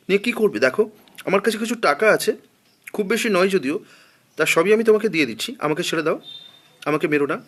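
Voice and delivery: a scared man bargaining with money; frightened pleading. A narrator's reading. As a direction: beg him not to kill you. Pleading with knife at back.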